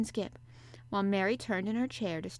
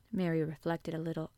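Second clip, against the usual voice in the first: plain reading voice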